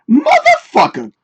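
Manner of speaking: a high, slow, stretched-out squeal of frustration; high drawn-out curse at the screen; frustrated cry. Real, not acted. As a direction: yelp it way up high in exasperation. Finds a duplicate file.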